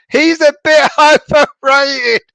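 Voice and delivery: reading "He's a bit overrated." slowly while breaking into high laughter; can't get the word "overrated" out for laughing, voice jumping up; cracking up mid-line at the word "overrated". Real, not acted. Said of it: "thank you for making me laugh".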